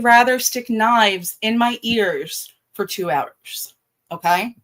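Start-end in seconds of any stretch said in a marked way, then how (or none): none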